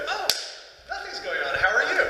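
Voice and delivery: mock-giddy greeting, building to a bright burst; giddy and excited. Neighbor greeting him in the hall, imitated giddy excitement. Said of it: "all giddy and excited".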